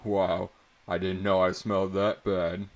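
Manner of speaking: loud mock-offended joking; comedic boast. A narrator's reading. Cocky boy to class, joking loudly about his smell. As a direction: ham up a cocky joke, loud. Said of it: "in a comedic fashion".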